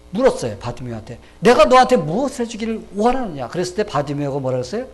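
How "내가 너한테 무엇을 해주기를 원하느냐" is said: loud, raised, forceful asking; emphatic question. Acted. Jesus asking Bartimaeus again what he wants, loud and emphatic. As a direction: put the question with full force.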